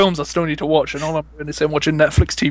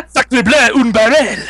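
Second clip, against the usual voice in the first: higher-pitched mock French exclamation, French accent; 4 semitones higher, 3 dB louder, slower than usual. Co-host riffing French clichés, French accent. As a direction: cry it in a silly French accent. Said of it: "say it with the accent as well".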